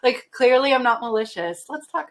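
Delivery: whiny half-crying plea; hammed-up wounded protest; mock plea. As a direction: play up the hurt like a mock sob. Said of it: "And I was like".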